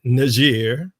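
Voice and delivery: Niger said as "nuh-ZHEER"; alternative pronunciation. Shown as a demonstration. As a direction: say Niger French-style, stressing a long "zheer". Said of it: "however you wanna pronounce it".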